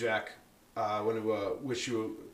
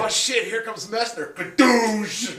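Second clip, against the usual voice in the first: loud; 11 semitones higher, 12 dB louder, much slower than usual. Pretend. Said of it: "acted guard spotting Messner".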